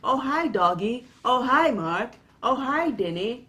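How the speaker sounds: high, hammed-up singsong hello; bad actor's greeting. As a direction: imitate the same oddly cheery greeting each time. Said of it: "he greets everybody by saying".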